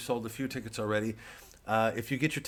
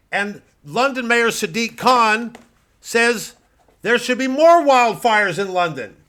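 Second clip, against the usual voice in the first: much higher and louder, indignant; outraged; 12 semitones higher, 13 dB louder, slower than usual. Acted. Pundit blaming London mayor, high and outraged. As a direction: spit out the outrage.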